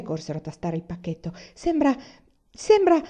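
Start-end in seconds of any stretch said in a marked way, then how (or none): 1.5-3.0 higher, hesitant half-sentence; breathless hopeful stammer; timid hope. A narrator's reading. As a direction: afraid to finish the thought. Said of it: "senza azzardarsi a continuare la frase per timore".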